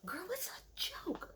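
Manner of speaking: whispered, drawn-out exasperation; hushed yell. Real, not acted. Telling Sutton it's a joke while boyfriend sleeps, exasperated whisper. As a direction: strain high but keep it whispered. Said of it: "'Cause I'm, my boyfriend's sleeping".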